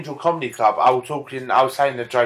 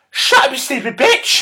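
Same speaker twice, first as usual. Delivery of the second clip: higher, sharp put-down; mock Barbara snap; 10 semitones higher than usual. Barbara answering her friend, higher mock retort. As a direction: snap it back as the old lady.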